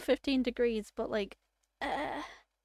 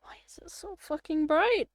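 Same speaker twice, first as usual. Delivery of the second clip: exasperated outburst; 5 semitones higher, 4 dB louder than usual. Real, not acted. Complaining about the sunlight.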